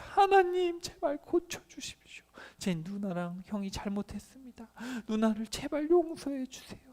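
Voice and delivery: desperate prayer. Acted. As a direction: beg God breathlessly for her healing. Moses praying for his sister.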